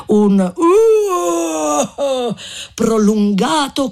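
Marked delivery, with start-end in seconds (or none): 0.5-2.3 high drawn-out jeering howl; triumphant whoop. Acted. The monatti jeer at the fleeing crowd, a long high triumphant "Uh!". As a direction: whoop after the fleeing mob. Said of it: "s'alzò un urlo di trionfo".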